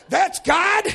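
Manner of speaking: high, loud, astonished question; amazed shout. Acted. Brother learning it is God, amazed shout. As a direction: cry it out in disbelief.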